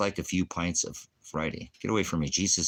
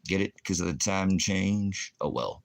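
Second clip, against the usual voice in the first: normal talking voice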